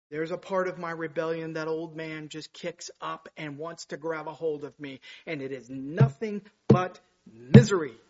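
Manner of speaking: ordinary talk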